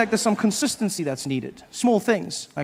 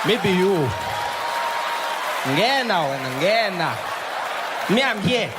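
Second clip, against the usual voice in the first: reluctant player, declining. Retold or imitated.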